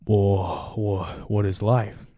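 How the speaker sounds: mock lost without a phone, drawn out; hammed-up bewildered daze. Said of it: "you're like".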